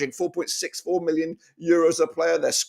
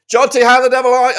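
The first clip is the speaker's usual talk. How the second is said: hearty greeting; 9 semitones higher, 12 dB louder, faster than usual. Real, not acted. Greeting guest on the call.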